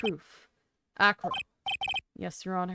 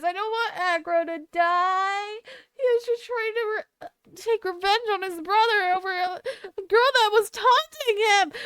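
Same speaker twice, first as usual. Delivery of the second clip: tearful and higher, words breaking; crying; 12 semitones higher, 6 dB louder than usual. Real, not acted. Player defending Acro while crying, tearful plea. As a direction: plead through real tears. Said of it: "I'm gonna cry".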